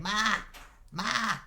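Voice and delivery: high, loud calls for mother; kid yelling. Acted. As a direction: holler like a small boy across the house. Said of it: "you hear him yelling".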